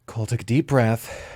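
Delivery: ordinary delivery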